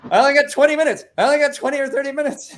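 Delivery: high, loud, fast panicked hurry; racing the clock, frantic; frantic rush. Retold or imitated. To himself, racing home before the edible hits: frantic high rush.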